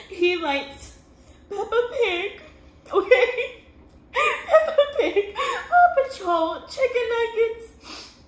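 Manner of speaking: very high, loud tearful wail; real sobbing. Real, not acted. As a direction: sob the words out, stretched slow and high. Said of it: "I'm gonna start crying".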